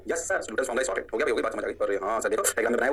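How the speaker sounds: a lightly voiced student reply, low; mock student voice. Teacher voicing his class as students saying yes sir.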